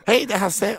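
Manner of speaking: high-pitched comic impression saying hello; put-on high voice, one of three identical characters; Hasse Brontén. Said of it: "samtal mellan din pappa, din mamma och Hasse Brontén". (ljus, tillgjord imitationsröst)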